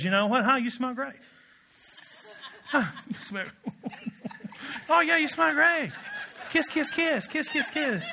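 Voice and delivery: high, sing-song fake compliments, laughing through them; butter him up with syrupy, put-on praise; mock sweet flattery. Preacher voicing a fawning enemy, high and sickly sweet. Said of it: "an enemy just says".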